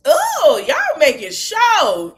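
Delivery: high, loud, slow ooh; excited ooh. Real, not acted. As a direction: savour the drama aloud. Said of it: "reacting to the show".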